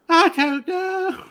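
put-on high, slow, frail old British woman. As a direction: quaver it out like a frail old lady. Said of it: "She's got the high frail British voice".

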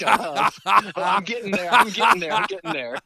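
loud, playful yelling; shouting. Real, not acted. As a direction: yell it over the laughter. Shouting back at laughing friend.

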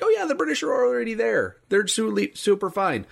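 high, fast mock voice of a breezy officer. Mocking the British officers' reassurance.

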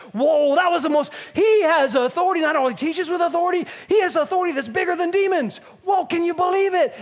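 high, loud, excited chatter; amazed crowd. Acted. Churchgoers heading to lunch marvel at Jesus, amazed.

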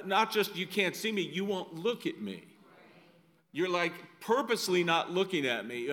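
ordinary delivery

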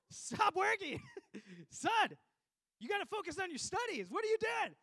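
high, very loud panicked yell; dad yelling. Retold or imitated. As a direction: yell it like a frantic immigrant dad. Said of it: "He just yells".

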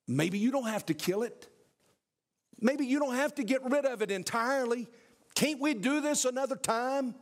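a lightly voiced sly lizard; tempting lizard. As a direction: coax him to wait, rising as you go. Lizard tempts hesitating man.

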